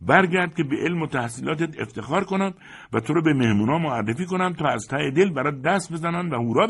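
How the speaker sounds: very high, loud, urgent begging; pressing, high-pitched entreaty; insistent pleading. A narrator's reading. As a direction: insist that he must come back. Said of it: "ساقدوش با اصرار گفت".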